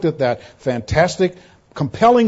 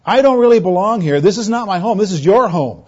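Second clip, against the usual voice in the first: higher, louder emphatic plea of a foreigner; Stephen addressing Sadducees; 5 semitones higher, 4 dB louder than usual. Acted. Foreign-born Stephen tells the Sadducees where home is, emphatically. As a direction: push the contrast of "my home" and "your home". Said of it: "so he's saying to them".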